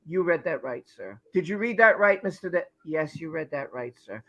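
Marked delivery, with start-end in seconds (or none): 0.0-1.2 lower, softer mock Johnny reply; mock Johnny answering the lawyer, low and soft; imitating Johnny. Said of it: "at least Johnny said". 2.9-4.2 lower, softer mock Johnny reply; mock Johnny answering the lawyer, low and soft; imitating Johnny. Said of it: "at least Johnny said".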